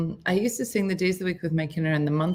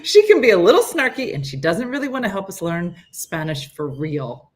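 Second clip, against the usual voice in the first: ordinary talk, nothing marked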